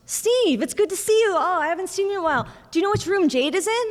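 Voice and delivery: high-pitched, quick imitation of a friendly mom; chatty mom greeting. Mom greets the nurse and asks for her daughter's room, high and chatty. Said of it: "I hear my mom".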